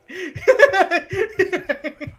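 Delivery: high burst of loud laughter; big laugh. Real, not acted. Friend laughing at a wrestler stomping like putting out fire, loud high laugh. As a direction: cackle out loud at the joke.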